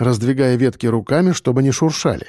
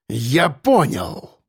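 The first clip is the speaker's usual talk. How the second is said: menacing realisation; much slower than usual. A narrator's reading. Boss realises men defy him.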